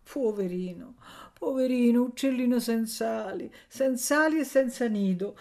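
high, cooing pity. A narrator's reading. Woman pitying an abandoned boy, compassionate. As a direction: coo over a poor orphan, swelling with compassion. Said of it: "diceva pietosamente la donna".

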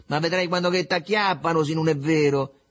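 light, jolly taunt; gleeful teasing. A narrator's reading. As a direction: tease him merrily with the threat. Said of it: "sfottendolo tutto giocondo".

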